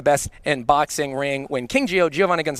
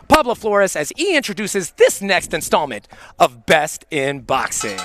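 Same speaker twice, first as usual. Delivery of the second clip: hyped up; 3 semitones higher, 4 dB louder than usual. Real, not acted. Commentator hyping the show.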